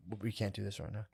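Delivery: soft, low, withdrawn quote; meditative calm. Retold or imitated. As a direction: quietly shut the argument down. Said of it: "strict meditation".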